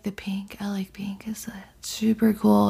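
whispered sleep talk, soft and dreamy; sleepy whisper. Retold or imitated. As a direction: murmur it like talking in your sleep. Sleep talk acted out as quote.

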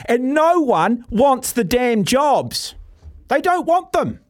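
slow, high-pitched emphatic disbelief; exasperated. Real, not acted. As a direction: drive each word home, voice climbing in exasperation. Exasperated rant about unwanted coaching jobs.

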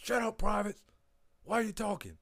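high, loud mock sergeant snap; scolding drill sergeant. Drunk drill sergeant scolding chattering privates, high and loud. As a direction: bark at the privates in formation.

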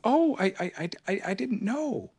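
a shy squeak of apology; timid Piglet. Acted. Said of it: "said Piglet".

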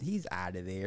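usual speaking voice

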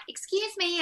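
high, affronted mock American; obnoxious American. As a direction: imitate an obnoxious American actress. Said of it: "she was being, like, really, really obnoxious".

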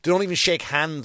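ordinary talk

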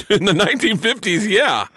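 high-pitched, laughing reply. Real, not acted. Host cracking up at the billiard hall guess, laughing. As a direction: answer through laughter, voice riding up high.

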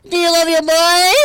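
put-on very high, slow, loud child voice; squeaky boy calling his dad; Trump son voice. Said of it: "a perfect boy".

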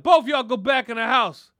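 booming shout at the neighbors; old man clearing the street with a yell; grandpa yelling. Retold or imitated. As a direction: bark it like the boss of the block. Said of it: "He'd be like".

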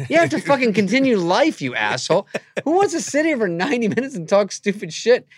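high, loud mock outrage; ranting comeback, hammed up; exasperated rant. Comedian retorting to friend about a hard out, exasperated rant.

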